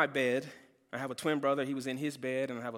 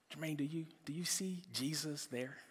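softer, breathless whisper of the counselor; whispered question; 10 dB quieter than usual. Retold or imitated. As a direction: lean in and whisper the question gently. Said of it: "He says".

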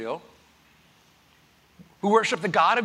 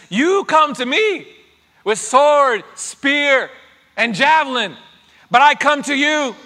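loud, high battle cry at the giant; David's yell; 8 semitones higher, 9 dB louder than usual. Acted. David shouting defiance at the giant Goliath, loud and high. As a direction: shout it across the field at Goliath.

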